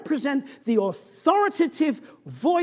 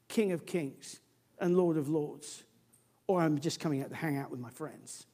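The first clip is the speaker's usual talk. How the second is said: much softer and lower; sudden drop; 8 semitones lower, 9 dB quieter than usual. Real, not acted. Preacher to congregation, dropping soft and low. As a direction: pull right back, almost confiding. Preacher drops voice after climax.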